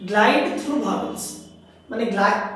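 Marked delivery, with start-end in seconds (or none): 0.1-1.2 drawn out very slowly, vowels sliding into each other; slow glide demo. Shown as a demonstration. Teacher slowly gliding through vowels for students. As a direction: stretch each vowel into the next. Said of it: "এই যে glide করছি".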